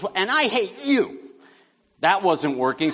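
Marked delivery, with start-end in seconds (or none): 0.0-1.2 put-on high childish pout; imitating bratty daughter. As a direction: whine it like a frustrated kid. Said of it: "so she goes".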